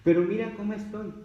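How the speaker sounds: high-pitched acted complaint; protesting plea. Acts believer protesting to Jesus. (queja suplicante actuada)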